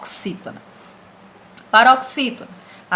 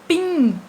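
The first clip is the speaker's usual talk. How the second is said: PINGUIM with a long-held final GUIM; stress on GUIM; 6 semitones higher than usual. Shown as a demonstration. Teacher shows which syllable is strongest, stress on GUIM. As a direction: stretch the last syllable of "Pinguim". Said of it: "O guim é a sílaba mais forte".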